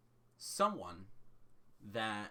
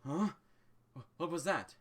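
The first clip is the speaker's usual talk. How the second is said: startled, sudden, louder; startled boy; 7 semitones higher, 6 dB louder than usual. Acted. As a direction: jump at a noise. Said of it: "and you hear".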